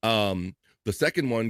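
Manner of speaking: ordinary delivery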